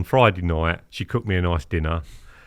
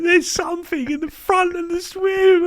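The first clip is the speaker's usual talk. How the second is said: scared mum. Retold or imitated. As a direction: squeak it out like a mum terrified in the dark. Frightened mum in the dark.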